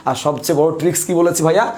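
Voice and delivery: normal talking voice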